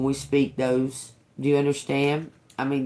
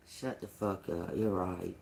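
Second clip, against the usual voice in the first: hushed mock retort; 7 semitones lower, 13 dB quieter than usual. Retold playful reply, voice drops low.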